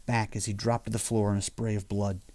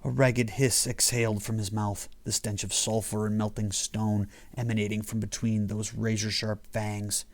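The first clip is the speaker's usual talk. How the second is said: ordinary delivery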